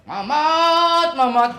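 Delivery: high, loud shout of a name; calling out. Acted. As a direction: holler for someone out of sight. Boss calls out for Mamat. (berteriak memanggil orang)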